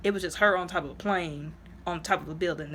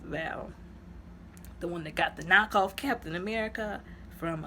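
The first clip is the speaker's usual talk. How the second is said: ordinary talk, nothing marked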